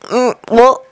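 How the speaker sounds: a drowsy murmur just waking; barely awake, mumbling; sleepy mutter. Acted. Ori woken by his king, a hint of sleepy mumble. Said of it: "Ori muttered sleepily".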